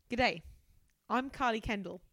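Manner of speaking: hammed-up Australian greeting; horrendous Australian. Carly introducing herself, in a horrendous Australian accent. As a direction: put on a bad Aussie accent. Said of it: "Horrendous Australian".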